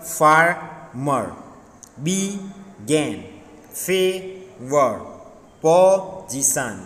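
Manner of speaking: "farmer, began, favor, position" broken with pauses; far... mer, be... gan in "farmer, began, favor, position"; split into syllables. Shown as a demonstration. Teacher dictating words for syllable counting, slowly split apart. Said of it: "words with two syllables".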